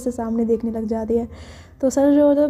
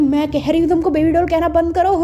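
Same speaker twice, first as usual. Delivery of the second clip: heated, higher-pitched demand to stop; a touch of acted fury, voice raised; angry; 5 semitones higher, 4 dB louder than usual. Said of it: "वो इतना गुस्सा कर रही होती है".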